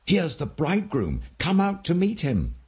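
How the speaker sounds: high, excited call to wake the sleepers; ringing cry. A narrator's reading. A watchman calling the sleeping virgins, high excited cry. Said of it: "The cry rang out".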